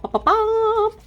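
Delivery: high, squeaky sound effect bops; playful bops. Pretend. Showing her app, playful high bops. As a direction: pop it up high like a cartoon. Imitating sound while demonstrating.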